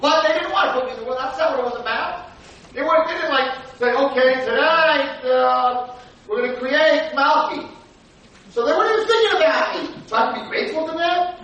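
high, loud protesting voice; indignant objection. Acted. Child arguing about being grateful to parents, indignant. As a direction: argue back loudly, acting the aggrieved child.

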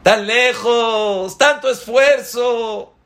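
scoffing exclamation at a wasted journey; mocking exaggeration. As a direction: stretch it out to mock the fool's effort. Mocks the foolish traveler.

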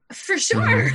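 high, loud, overdone agreement; over-eager yes. Retold or imitated. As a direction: chirp it far too eagerly. Eager yes hiding her doubt.

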